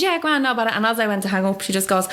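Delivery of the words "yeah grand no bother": mock-breezy, higher-pitched reply; raised voice, making out all is fine; breezy brush-off. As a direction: lightly play it cool on the phone. Brushing off the hospital caller.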